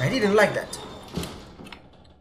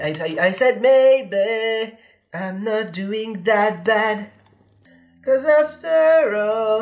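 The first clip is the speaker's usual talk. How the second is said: slowed down; much slower than usual. Pretend. Slowed, distorted drawn-out words.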